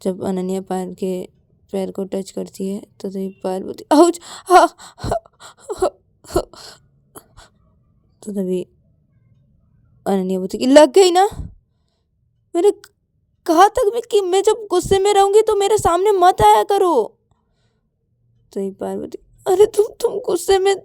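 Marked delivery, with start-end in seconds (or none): 3.9-6.4 high pained cry, breaking into gasps; sobbing ows; yelp of pain. Acted. Hurt foot is touched. 10.6-16.9 loud, high-pitched telling-off; heated reproach, raised voice; angry scolding. Acted. Angry scolding of hurt friend.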